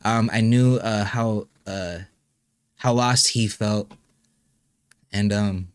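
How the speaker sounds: holding back real tears, halting with long pauses; choked up, stopping and restarting; trying not to cry. Real, not acted. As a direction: fight to keep composure while speaking of a loss. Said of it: "I was trying not to cry".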